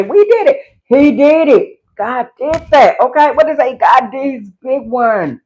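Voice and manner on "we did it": a light higher-pitched Doris cheer; mock-celebrate like Doris; imitating Doris. Quoting Doris cheering we did it, lightly voiced. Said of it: "You know how Doris say".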